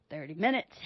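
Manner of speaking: ordinary talk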